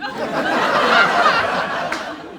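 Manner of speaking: very high squealing laugh; high shriek laugh. Real, not acted. Comic cracking up at mom's question, shrieking laugh.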